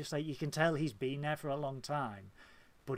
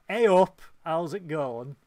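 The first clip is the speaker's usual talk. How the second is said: loud, hearty Hull greeting; playful Hull accent, starting very loud; 3 semitones higher, 11 dB louder, slower than usual. Acted. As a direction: belt out a broad Hull hello. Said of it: "The rest of us are just like".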